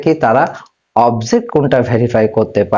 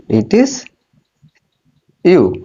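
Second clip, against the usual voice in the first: teacher pausing before "you" to show who is meant; stress on you; 2 semitones higher, much slower than usual. Shown as a demonstration. Teacher to class, pausing hard before the stressed you. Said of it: "দেখো কাকে বলা হচ্ছে".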